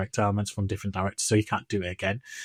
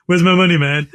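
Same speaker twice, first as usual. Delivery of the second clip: louder mock-menacing demand; angry Stewie; 5 semitones higher, 7 dB louder than usual. Stewie beats the dog for his money, louder mock threat. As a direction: bark it like an angry cartoon baby.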